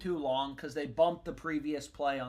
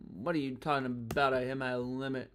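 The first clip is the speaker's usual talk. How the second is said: normal talking voice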